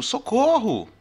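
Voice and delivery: socorro cried out high and loud; exclaimed socorro. Shown as a demonstration. Teacher shows socorro as exclamation, cried loud. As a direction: shout socorro as a real call for help. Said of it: "Mas se eu digo".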